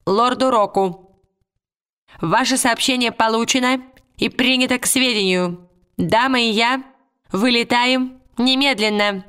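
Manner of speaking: formal spy report. Acted. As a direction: read it like a tiny soldier reporting to his lord. Tialis answers.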